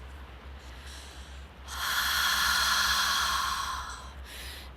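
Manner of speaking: long open-mouthed "haaa" breath without voice; breathy HA exhale. Shown as a demonstration. Teacher demonstrating Ujjayi breath, long audible HA sigh. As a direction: exhale slowly as if steaming up a mirror. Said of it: "making this H A sound".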